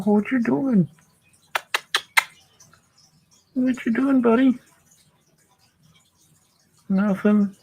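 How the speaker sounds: high, sing-song puppy talk. Real, not acted. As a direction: owner cooing to sleepy puppies. Baby talk to puppies.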